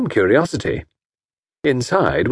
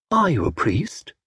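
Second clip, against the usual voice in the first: demanding; 6 semitones higher, 4 dB quieter than usual. Acted. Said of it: "she demanded".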